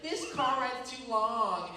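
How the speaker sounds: exaggerated high, slow whine; complaining. Passenger griping about the long drive, whiny complaining. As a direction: drag it out like a bored passenger. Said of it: "someone complain about everything".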